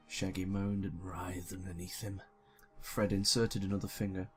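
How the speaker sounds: ordinary talk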